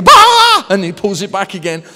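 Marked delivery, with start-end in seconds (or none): 0.0-0.7 very loud, high mock burst of laughter; imitated laughing outburst. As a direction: explode into a sudden high "Bah!". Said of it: "And they go".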